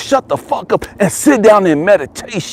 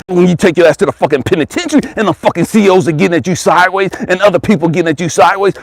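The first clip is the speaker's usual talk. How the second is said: ordinary delivery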